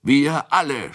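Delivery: high-pitched, eager shout. A narrator's reading. Drinker volunteering everyone for the cellar, shouting. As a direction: cry out for the whole group. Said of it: "schrie Kunicke".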